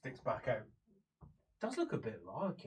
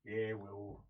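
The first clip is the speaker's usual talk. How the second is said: offhand news; 6 semitones lower, 7 dB quieter, slower than usual. Acted. Visitor breaks news of death.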